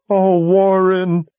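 the wail of a woman seeing her love dying; high, slow cry of anguish. A narrator's reading. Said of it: "Verna moaned".

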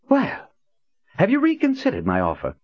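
high, pointed question; lightly voiced imperious old man; Prophet voice.

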